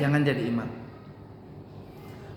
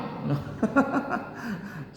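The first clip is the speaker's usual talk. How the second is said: soft laugh; 4 dB quieter than usual. Real, not acted. Amused by the long shawl.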